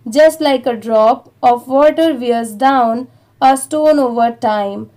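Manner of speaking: very slow, chunked reading with pauses between phrases; slow read-aloud. Shown as a demonstration. English teacher reading the paragraph to Hindi learners, very slow and chunked. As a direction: read it out word by word for learners to follow. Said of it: "एक बार इसे भी थोड़ा थोड़ा करके पढ़ते हैं".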